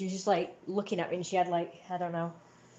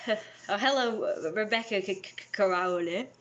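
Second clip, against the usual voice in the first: startled gasp; 4 semitones higher than usual. Real, not acted. Surprised by a visitor arriving.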